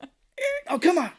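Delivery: high, loud comic complaint; hammed-up frustration at a noisy stair; mock exasperation. Acted frustration at creaking stairs.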